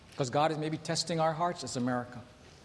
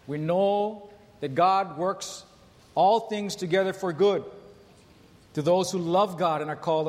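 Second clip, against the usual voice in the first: scripture reading; 3 dB louder than usual. Acted. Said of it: "very familiar scripture".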